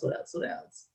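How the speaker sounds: muttering under the breath, searching for words; a private whisper while searching memory; whispered to herself. Real, not acted. Thinking aloud, unvoiced.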